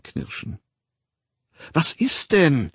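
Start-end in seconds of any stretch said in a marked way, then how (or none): 1.7-2.7 high, alarmed outcry; near scream. A narrator's reading. Frightened woman asking the raging man, near scream. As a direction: cry it out, just short of screaming. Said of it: "schrie sie beinahe".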